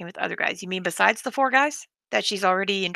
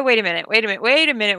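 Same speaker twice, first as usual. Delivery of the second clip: usual speaking voice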